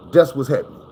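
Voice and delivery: ordinary delivery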